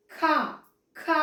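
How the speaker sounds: ఖ with a strong puff of breath; aspirated kha. Shown as a demonstration. Teacher asking children to notice it, breathy-released ఖ. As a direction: push air out on each ఖ. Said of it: "గమనించాలి".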